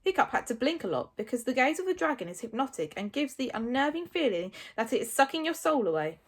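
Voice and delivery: usual speaking voice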